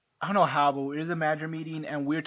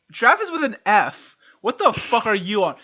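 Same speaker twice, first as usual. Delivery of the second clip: louder, higher mock outrage; retold indignant challenge, voice raised; incredulous correction; 4 semitones higher, 6 dB louder than usual. Managers correcting the boss's spelling, mock-incredulous outburst.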